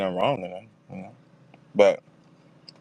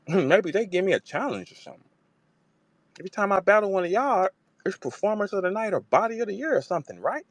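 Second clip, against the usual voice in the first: imitating Jazz; 7 semitones higher than usual. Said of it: "She said".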